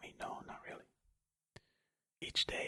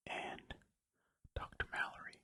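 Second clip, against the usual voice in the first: ordinary delivery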